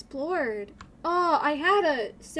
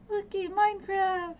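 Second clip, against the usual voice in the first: high, silly put-on cheer; sing-song goofy celebration; 8 semitones higher, slower than usual. Streamer to chat, a goofy high cheer for Minecraft.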